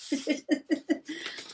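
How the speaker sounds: short high breathy chuckle; laugh. Real, not acted. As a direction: laugh lightly at yourself. Laughs at her eye drop busyness.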